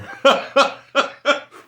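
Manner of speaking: sharp high laugh; high burst of laughter; loud high laugh. Real, not acted. Big laugh.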